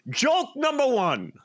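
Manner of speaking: hammed-up German comic intro; mock German announce. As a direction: declare it like a stiff showman. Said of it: "He goes".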